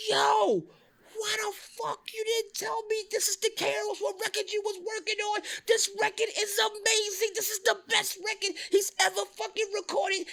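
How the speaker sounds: very high-pitched, hollering impression of a worked-up caller; hammed-up, high excited rant; imitating phone yelling. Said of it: "he's yelling in the phone".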